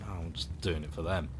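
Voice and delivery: weary mutter. Retold or imitated. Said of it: "now I'm like".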